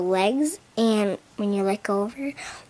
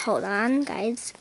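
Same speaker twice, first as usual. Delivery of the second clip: ordinary talk, nothing marked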